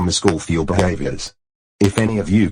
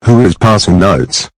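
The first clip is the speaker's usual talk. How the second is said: stern shout; 13 dB louder than usual. Acted. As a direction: boom it at the class. Teacher catches note.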